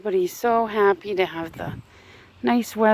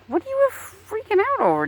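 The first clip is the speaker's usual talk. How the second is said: high soothing pet talk; 12 semitones higher than usual. Real, not acted. Soothing startled bird.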